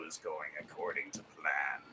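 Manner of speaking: low, soft villain aside; hammed-up sinister satisfaction; scheming villain. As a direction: gloat quietly like an evil robot. Evil bot's villain line.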